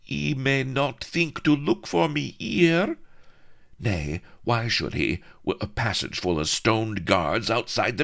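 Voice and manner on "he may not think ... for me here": high, breathless damsel pleading; high pleading heroine. Acted. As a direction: beg to be hidden, pitched high.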